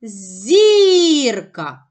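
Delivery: «Зірка» called out with a long, loud first syllable; calling the word. Shown as a demonstration. Teacher finding the stress by calling, drawn-out call. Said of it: "Покличемо слово".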